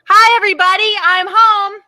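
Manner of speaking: put-on high, loud little-girl holler; loud child shout. Ruby surprises the neighbours by calling out, loud high holler. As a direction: belt it out like a loud kid coming home. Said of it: "she turns it right up".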